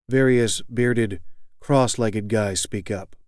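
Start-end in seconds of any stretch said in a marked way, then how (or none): none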